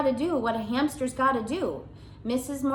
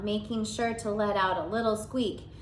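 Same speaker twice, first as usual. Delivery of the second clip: ordinary delivery, nothing marked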